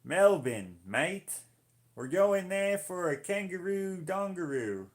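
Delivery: loud, high-pitched hammed-up Australian; cartoonish Australian tourist-ad shout; Aussie accent. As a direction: put on a silly, exaggerated Aussie accent. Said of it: "Aussie accent".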